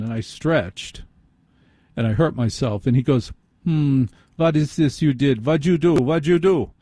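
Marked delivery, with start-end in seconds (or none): 3.6-6.7 put-on German accent, pitched higher; mock German accent. Foot surgeon examining the injury, hammy fake German accent. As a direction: imitate a doctor faking a comic German accent. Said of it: "yelling at me with a German accent".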